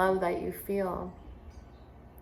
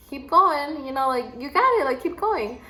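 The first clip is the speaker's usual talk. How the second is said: a touch of lifted, louder cheering on; mild encouraging call; 6 semitones higher, 4 dB louder than usual. Retold or imitated. Teacher cheering a boy up the rock wall, lightly raised encouragement. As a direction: urge him up the wall, brighter.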